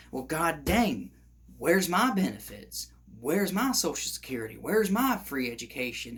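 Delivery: Southern twang, griping as an angry citizen; weird Southern accent. Acted. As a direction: slip into an indignant Southern accent. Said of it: "that weird Southern accent".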